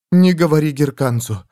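panting, hushed and urgent; breathless whisper. Acted. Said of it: "задыхаясь, прошептала она".

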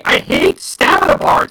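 real frustrated whine; drawn-out complaint; whiny hate. Real, not acted. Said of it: "I am so pissed off".

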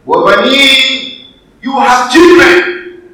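loud, high, drawn-out announcement; slow, ringing preacher's shout; proclaiming. Acted. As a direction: call out the promise to the crowd. Preacher proclaims the promised favours.

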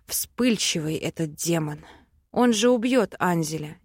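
usual speaking voice

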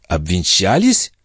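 slow questioning echo, rising at the end; incredulous echo. A narrator's reading. The princess questions the count's claim of marriage, incredulous. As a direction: echo it back in drawn-out disbelief.

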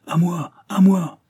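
loud, high call for help; desperate call. Acted. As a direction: shout for help in despair. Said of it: "Il jeta un appel désespéré".

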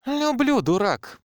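high, loud snap; exasperated shout. A narrator's reading. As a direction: cut him off with a yell. Girl snaps and kisses.

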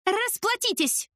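high, slow, barked order; sudden bark. Acted. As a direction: snap it at the customers out of nowhere. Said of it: "вдруг рявкнула я". (официантка рявкает на клиентов)

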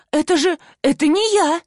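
high-pitched, stammered denial; indignant protest. A narrator's reading. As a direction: protest your innocence, voice jumping up. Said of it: "я моментально возмутилась". (возмущённо оправдываясь)

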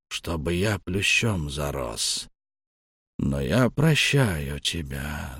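put-on slow, soft, halting old man's voice; a frail paralysed old man forgiving his son. A narrator's reading. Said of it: "медленно пережёвывая каждое слово". (дряхлый старик, медленно и тихо)

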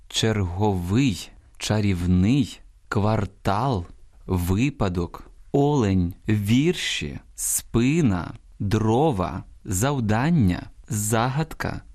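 slow word list, each stressed syllable stretched; model word stresses. Shown as a demonstration. Teacher showing listeners correct stresses, slow careful dictation. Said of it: "Ось саме так слід наголошувати ці слова".

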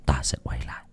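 low, hushed threat sinking into a whisper; menacing vow. Acted. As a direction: quietly promise revenge, ending in a whisper. Vows to return, menacing the family. (lời đe dọa trầm, thì thầm)

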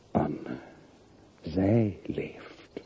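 soft, inward realization; hushed to himself. Acted. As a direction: murmur her name as if only to himself. Quiet reflection on Anne alive.

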